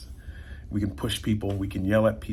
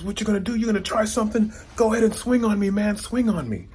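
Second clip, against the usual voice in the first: taunting challenge; 11 semitones higher than usual. Retold or imitated. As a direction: goad him to swing, pitched way up. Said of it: "they're yelling at this guy".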